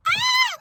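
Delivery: sudden high delighted shriek; a real squeal of delight; excited squeal. Real, not acted. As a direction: squeal with fangirl joy. Squealing at cute couple.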